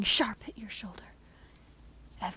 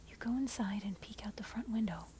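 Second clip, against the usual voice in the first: plain reading voice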